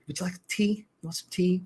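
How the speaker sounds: lightly voiced fussy host offering tea; a hint of a prim tea-offering friend; imitating Chris. Imitating Chris offering George tea, lightly voiced. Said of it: "I loved it. That was my favorite line".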